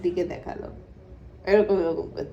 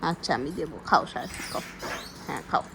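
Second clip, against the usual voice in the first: soothing softly; 5 semitones lower, 8 dB quieter than usual. Real, not acted. Soothing upset toddler.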